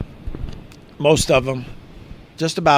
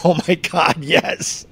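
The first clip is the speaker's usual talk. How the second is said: mock excited listeners; 4 dB quieter, slower than usual. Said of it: "people are saying".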